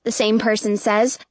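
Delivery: ordinary delivery, nothing marked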